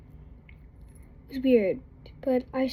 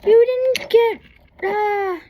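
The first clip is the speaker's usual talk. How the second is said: put-on flustered kid; 9 semitones higher, 6 dB louder than usual. Girl fumbling, caught out.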